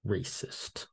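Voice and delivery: a dry, breathy whisper dropped at the end of the list; whispered aside. Acted. Reading the poll results to viewers, drops the last option as a sly whisper.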